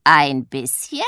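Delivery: loud, slow, scornful echo; scornful call. A narrator's reading. Witch mocks her sister's timid idea, loud and slow. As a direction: throw the words back at her. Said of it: "ruft Pumpernickel".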